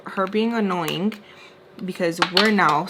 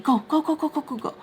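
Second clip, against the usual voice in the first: rapid, high-pitched shooing; frustrated; 7 semitones higher, faster than usual. Retold or imitated. Father shooing his annoying daughter away, frustrated. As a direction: imitate a frustrated father's string of go's. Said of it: "gets frustrated and tells her".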